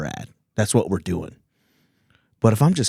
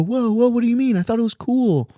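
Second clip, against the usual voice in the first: mocking comic voice; 9 semitones higher than usual. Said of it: "And then they go".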